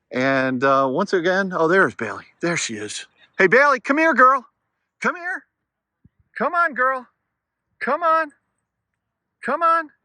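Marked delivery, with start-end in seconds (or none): 3.4-9.9 calling the dog. Real, not acted. Owner calling his dog over at the park, high and bright. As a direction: sing out her name to bring her back.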